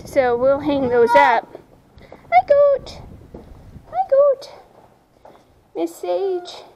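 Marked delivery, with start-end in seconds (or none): 2.3-4.5 sing-song high greeting to a goat; high pet voice. Real, not acted. Greeting her goat in a high cooing voice. 5.7-6.6 a touch of soft cooing; soft pet voice. Real, not acted. Calling softly to her goat Sage in a cooing voice.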